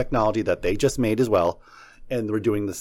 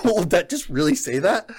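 higher-pitched, astonished question; amazed mock-reaction, pitched up; blown-away disbelief; 6 semitones higher than usual. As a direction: retell stunned disbelief. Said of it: "I was, like, blown away".

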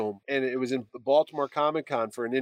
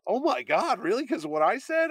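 high-pitched, mildly shocked disbelief; retold dismay; 8 semitones higher than usual. Artist learns he made a fan quit, retold dismay. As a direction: imitate someone taken aback.

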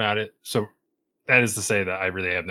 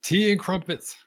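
higher, hammed-up British accent; mock British accent; 12 semitones higher, 4 dB louder than usual. Guest doubles down on his fake British accent, hammed-up. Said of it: "you weren't gonna do an accent".

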